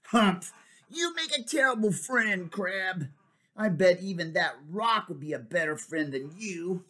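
high huffy reproach; annoyed jelly. Acted. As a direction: scold the grumpy crab. Jelly scolds Crab.

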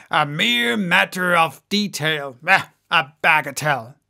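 lightly voiced blustering dismissal; airy dismissal. A narrator's reading. Maston shrugs off carrying out the plan, mildly dismissive. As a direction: wave it away as a trifle, in character.

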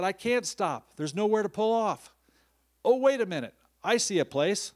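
high and loud, flustered driver who can't stop; retold frantic driver. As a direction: shout it out like a stressed driver spotting a spot. Driver's retold reply in panic.

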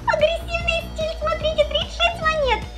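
high excited reading. Real, not acted. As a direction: read the loot out with glee. Reading game reward, high.

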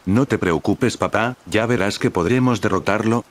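ordinary delivery

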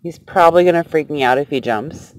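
normal talking voice

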